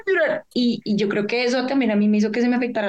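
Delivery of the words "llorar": high, squeezed mock crying; put-on tearful squeak; tearful imitation. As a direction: imitate choking up on the word. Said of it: "no podía pronunciar una palabra sin llorar".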